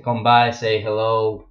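ordinary talk, nothing marked

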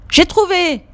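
high, delighted cry of discovery; excited exclamation. Acted. Julie finding the crêpe recipe in the cookbook, excited. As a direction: shout it out like you just found the treasure. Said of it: "s'exclame-t-elle".

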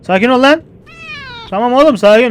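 loud, high calming yell; urgent shout. Real, not acted. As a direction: shout at it to calm down. Paniklemiş kediye bağırıyor. (bağırarak sakinleştirme)

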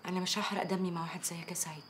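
very soft and low, holding back; contemptuous murmur. Acted. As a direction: refuse the fight under your breath. Restrained contempt in phone quarrel. (همس باستخفاف)

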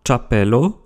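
"chapello" slowly, starting tcha; "chapello" drawn out as tcha-pe-lo; circumflex C as tch. Shown as a demonstration. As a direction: open "chapello" with a tch sound. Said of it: "se prononcent ch".

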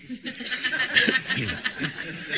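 high rustic chuckle; high laugh. Acted. Healer chuckling at the patient, high. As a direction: laugh off the question.

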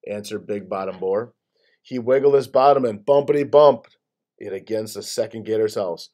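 ordinary delivery, nothing marked

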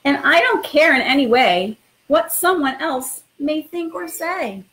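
expressive storytime read-aloud; reading to children. Acted. Principal reading a picture book to new students, lively storytime voice. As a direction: read it like bedtime story time for little kids.

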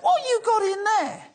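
incredulous question. Retold or imitated. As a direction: squeak it out like you can't believe the bag's size. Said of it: "And I'm like".

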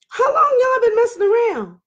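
high, sing-out questioning; pointed question. Retold or imitated. Wife asking the mistress about the affair, pointedly high. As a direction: pitch it way up as you probe.

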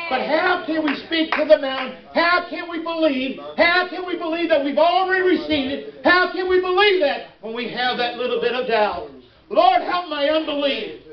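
high, driving pulpit delivery; full-force preaching. Acted. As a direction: preach it up to an explosive finish.

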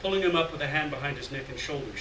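ordinary delivery, nothing marked

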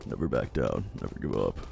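very low, soft mumble; stiff flat corpse mumble. Retold or imitated. Continuing the put-on voice.

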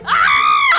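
burning scream. Acted. Caught by the fire, a sudden shriek. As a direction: shriek as the fire catches you. Player's character catches fire.

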